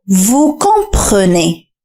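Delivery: "Vous comprenez" drawn out very slowly; slow model phrase. Shown as a demonstration. Teacher asks learners to listen closely, very slow demo. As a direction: stretch every syllable of "Vous comprenez". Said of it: "استمع جيدًا".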